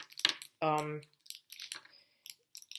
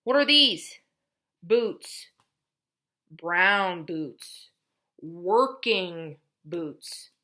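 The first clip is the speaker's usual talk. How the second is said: teacher modeling; 7 dB louder, much slower than usual. Shown as a demonstration. Therapist naming pictures with a child, lively. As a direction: coax a child to name things. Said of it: "sentence level".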